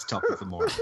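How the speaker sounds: high mocking Irish lilt; mock Irish accent. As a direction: parody the Irish phrase. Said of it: "nobody says".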